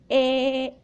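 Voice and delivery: held "Eh" with mouth open; saying eh. Acted. Boy opens mouth for dentist, saying eh. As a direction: draw out "Eh" on an e vowel. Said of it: "no digas eh".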